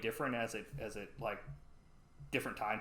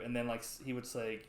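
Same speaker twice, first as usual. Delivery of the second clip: ordinary talk, nothing marked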